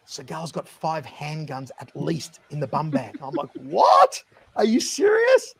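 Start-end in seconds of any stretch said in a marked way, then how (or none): 0.1-3.2 soft, slow secret report; hushed confiding. Retold or imitated. Producer reporting back to the host about guns, hushed confiding. 3.6-5.5 very high-pitched incredulous squeal; shocked disbelief. Retold or imitated. Host hearing about handguns in the bum bag, shocked disbelief. Host's shocked reply, retold.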